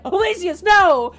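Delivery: high, loud mock "no!"; horrified comrades' cry. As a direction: cry out as comrades watching him fall. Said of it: "salamanders are like".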